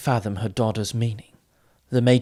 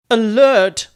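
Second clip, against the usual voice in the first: loud sudden cry, much higher; feigned alarm cry; 11 semitones higher, 9 dB louder than usual. A narrator's reading. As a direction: fake a warning to fool him. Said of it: "Dinin cried suddenly".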